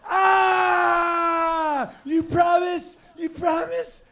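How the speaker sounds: very high, very loud squealing dog; ecstatic dog shriek. Retold or imitated. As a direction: squeal with disbelieving joy. Dog hears going outside.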